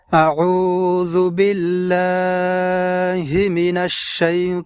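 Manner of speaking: slow, drawn-out tajweed chant; Quran recitation. Shown as a demonstration. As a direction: recite slowly with long held vowels. Recites taawudh and basmala. (ধীরে টেনে কুরআন তিলাওয়াত)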